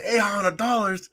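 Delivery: mock outrage in a Russian accent. Cab driver balking at the price in a Russian accent. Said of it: "with his little accent".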